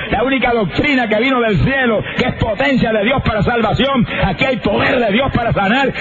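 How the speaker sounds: cadenced proclamation building to a high cry; fervent preaching. Real, not acted. Preacher proclaiming the gospel of Jesus Christ, fervent preaching building high. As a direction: drive each phrase upward to a climax of praise.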